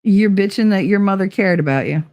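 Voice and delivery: normal talking voice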